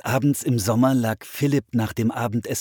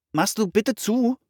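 much higher, rising plea; repeated request; 14 semitones higher than usual. A narrator's reading. Brother asks his distracted sister to shut the door, insistent repeated request. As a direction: ask a second time, pitched right up. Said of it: "bat Philipp nochmal".

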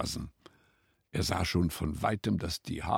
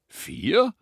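high, loud, astonished echo; surprised question; 14 semitones higher, 10 dB louder than usual. A narrator's reading. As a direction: question the number in disbelief. Rabbit doubts the hare's count. (erstaunt nachgefragt)

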